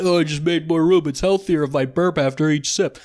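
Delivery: a lightly voiced, smug know-it-all; mocking burp believers. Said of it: "I've heard people say that".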